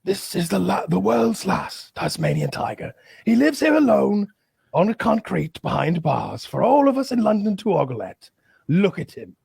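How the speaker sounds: mock-tragic museum narrator; mock zoo announcer. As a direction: do a melodramatic exhibit guide.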